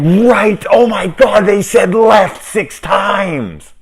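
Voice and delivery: high, loud, slow sing-song mock realization; hammed-up high sung exclamation; mock audience realization. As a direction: squeal it out like a dumb viewer finally getting it. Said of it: "it's like".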